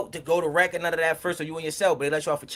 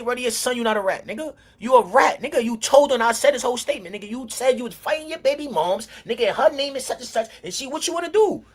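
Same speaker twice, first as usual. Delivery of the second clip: higher, louder retold callout; heated accusation; 6 semitones higher, 4 dB louder than usual. To Cobb Butter before the crowd, a louder, heated accusation. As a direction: throw the statement in his face.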